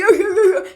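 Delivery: high, loud mocking cackle; mocking high laugh. Imitating the girl laughing at her, high mocking laugh.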